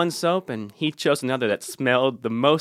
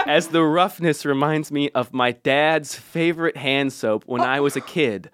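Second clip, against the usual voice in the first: usual speaking voice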